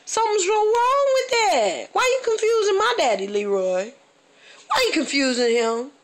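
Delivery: high, outraged complaint; comic scolding a local for confusing her dad, pitched high; indignant. Real, not acted. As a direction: call him out for confusing dad.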